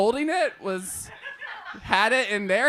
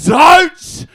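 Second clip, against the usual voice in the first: slow, loud mock yell; emphatic warning; 2 semitones higher, 12 dB louder, slower than usual. Nottingham woman heading off a question, loud slow warning. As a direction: cut them off firmly. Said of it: "she immediately says".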